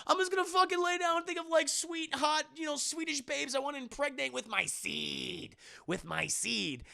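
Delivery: high-pitched rambling panic; freaking out. Retold or imitated. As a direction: babble it out high and frantic. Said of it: "Will Poulter's freaking the fuck out".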